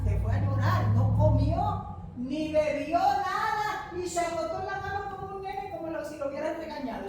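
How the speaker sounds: loud, high preacher's cry; impassioned sermon delivery; fiery preaching. Real, not acted. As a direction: preach it at full force to the congregation. Preaching at full force.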